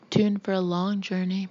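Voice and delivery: ordinary delivery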